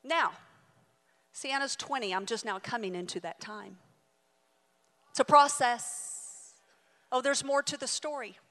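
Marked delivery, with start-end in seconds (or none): 5.1-6.5 drawn out and louder; slow emphatic. Real, not acted. Preacher summing up the lesson, slow and emphatic. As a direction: land it slowly.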